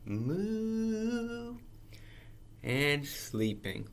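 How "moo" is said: long, soft, high, gliding moo; smooth moo. Acted. Reader demonstrates a cow's moo, smooth and soft. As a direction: glide smoothly through one high moo.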